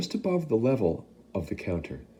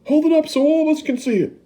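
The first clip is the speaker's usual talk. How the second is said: much higher, louder and fast; shouting; 7 dB louder, faster than usual. Acted. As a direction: demand to see it. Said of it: "shouted somebody".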